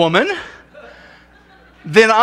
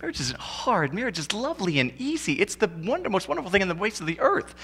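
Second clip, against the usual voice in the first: naive newlywed gush; 4 semitones higher, 9 dB quieter, faster than usual. Retold or imitated. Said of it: "you're like".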